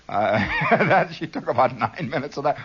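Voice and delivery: ordinary talk, nothing marked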